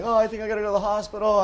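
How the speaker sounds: worried plea. Retold or imitated. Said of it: "I'm like".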